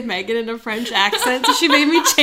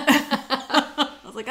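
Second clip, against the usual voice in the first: laughing through the lead-in; much slower than usual. Real, not acted. Laughing as she retells talking to her friend. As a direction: chuckle and slow down on the words.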